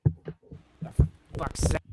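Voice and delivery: glitchy voice through a failing mic; words breaking up over a bad connection; scrambled voice. Real, not acted. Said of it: "Your mic is crapping itself out".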